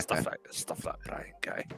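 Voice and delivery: low, slow whispered grumble; mock whisper. Guest secretly badmouthing Brian, mock whisper. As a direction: whisper it under the breath, slowly.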